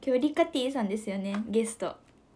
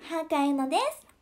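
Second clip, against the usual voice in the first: higher, put-on Yuna voice; self-intro as Yuna, pitched up; imitating Yuna; 7 semitones higher than usual. Asked to imitate Yuna, she pitches up. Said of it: "[name]くん、ユナくんのモノマネできる".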